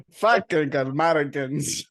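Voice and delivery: slow, loud, hammed-up French accent; old-movie villain with a dubious French accent; bad French accent. Co-host mocking the host's French impression, bad French accent. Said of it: "That was the bad guy from, like, an old world".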